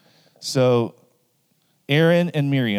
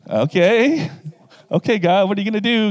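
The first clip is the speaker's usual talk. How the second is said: higher, mock-nervous pleading; nervous siblings; 8 semitones higher, 4 dB louder than usual. Siblings answering an angry God, higher and nervous. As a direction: squeak it out like kids caught out. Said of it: "And they're like".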